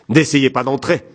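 high, loud cry through a window; shouted warning. A narrator's reading. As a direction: call out sharply to keep someone away. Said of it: "La femme cria par la fenêtre".

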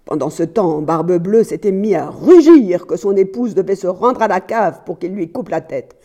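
plain reading voice

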